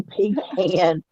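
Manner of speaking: "pecan" drawn out as PEE-can; pee-can pronunciation. Shown as a demonstration. Woman repeating what family asked her to say, "pecan" as PEE-can. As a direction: say "pecan" with ee first, then can. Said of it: "They asked me to say".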